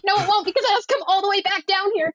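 high-pitched mock dismay; frantic protest. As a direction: retell a panicked objection, high and rushing at the end. Retold frantic inner protest to reassurance.

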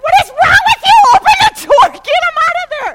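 high, very loud frantic yell; acted-out furious mom screaming orders. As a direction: scream at the kid in panic. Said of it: "I start screaming".

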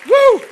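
very high, loud "woo"; exultant whoop. Real, not acted. Preacher proud to be an American, exultant whoop. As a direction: whoop in celebration. Cheering after patriotic rally.

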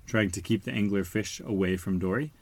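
ordinary delivery

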